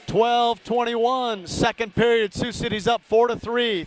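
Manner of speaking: brisk live game call; radio hockey announcer calling the puck; hockey play-by-play. Acted. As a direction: call the action as it happens.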